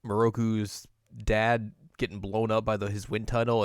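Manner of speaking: normal talking voice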